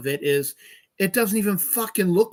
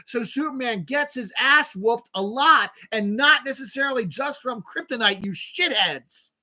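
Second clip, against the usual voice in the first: real angry rant; 6 semitones higher than usual. Real, not acted. Streamer ranting at clueless online movie complainers, angry. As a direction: tear into them, stretching out the insults. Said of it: "I was yelling".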